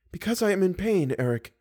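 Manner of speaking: high-pitched suffering captive; pained. A narrator's reading. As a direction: answer plaintively in a high voice. Bound captive tells captor of pain.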